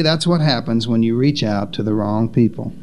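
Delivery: low, falling, disapproving quote; low-pitched mild disapproval of reaching "wrong" people; disapproving critics. Acted. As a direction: say it as a dismissive verdict, stress on "wrong". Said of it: "Some would say".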